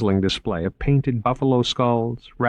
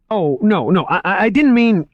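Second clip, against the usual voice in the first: loud, high, flustered stammer; 12 semitones higher, 6 dB louder than usual. A narrator's reading. Moriarty chases Margot, stammering, loud and high. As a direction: trip over the words in a panic. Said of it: "he stammered".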